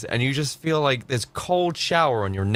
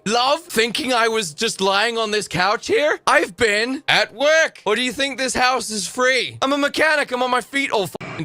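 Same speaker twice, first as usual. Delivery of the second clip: indignant mock rant; 5 semitones higher than usual. Streamer playing the tired mechanic, indignant and high. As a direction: fire back at her, high and annoyed. Streamer plays man answering nagging partner.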